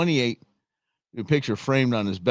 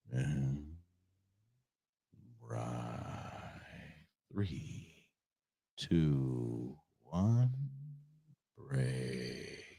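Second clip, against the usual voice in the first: low, soft, unhurried countdown; calm squad leader; 9 semitones lower, 15 dB quieter, much slower than usual. Retold or imitated. Host imitating the Blue Angels leader's calm countdown, low and soft. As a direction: imitate the commander cooling the gang, low and hushed. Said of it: "he's like this".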